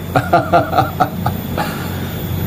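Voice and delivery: short breathy mocking laugh. Real, not acted. Vlogger mocking Rizik's silence, laughing. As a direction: laugh at him scornfully.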